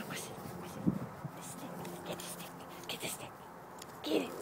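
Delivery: hushed whisper to the dog. Real, not acted. Whispering to dog about stick.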